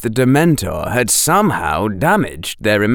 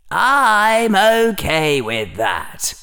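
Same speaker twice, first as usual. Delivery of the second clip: slow, drawn-out lightly voiced Slytherin; inner Slytherin; 8 semitones higher, much slower than usual. A narrator's reading. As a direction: stretch it out in untroubled agreement. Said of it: "said Slytherin".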